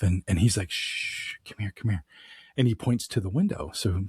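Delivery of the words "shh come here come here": whispered shush, then softer words; hushed whisper. Retold or imitated. Husband quietly hushes his wife toward the window, whispering. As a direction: hush her without alerting the creature.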